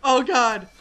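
high, loud frightened cries; scared horror game streamer. Real, not acted. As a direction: panic while running. Lost, being chased.

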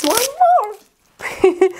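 very high, slow, stretched squeal; high excited squeal. Real, not acted. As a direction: squeal at another gift. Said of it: "excited I am".

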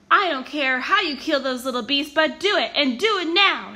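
loud, harsh villain order, rising; menacing loud demand; cruel command. A narrator's reading. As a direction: bark the order at the henchmen. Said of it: "she told".

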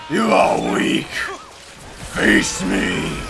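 harsh roared villain voice; hammed-up monster growl; monster taunt. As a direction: roar the challenge like a beast.

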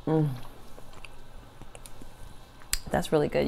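chewing while talking; savoring a bite; mouth full. Real, not acted. Seen 0: bite taken at -1, closed-mouth chewing while saying "Mm.

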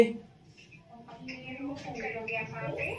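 soft, slow, coy whisper-like voice. Pretend. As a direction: sweet-talk him in a hushed voice. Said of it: "अनजान लड़की बन करके".

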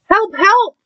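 very high cry for help; trumpeting elephant. A narrator's reading. Elephant calling for help, high trumpeting cry. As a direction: trumpet it high in storybook alarm. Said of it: "trumpeted Elephant".

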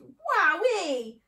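loud, high whoop of wonder; whoop, bowled over by the drink; astonished yelp; amazed exclamation. Acted. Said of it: "he gasped".

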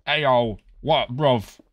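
play-threatening, loud; mock confrontation. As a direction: square up like a cartoon British lad. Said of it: "we will be like".

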